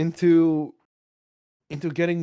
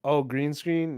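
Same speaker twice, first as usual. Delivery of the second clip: normal talking voice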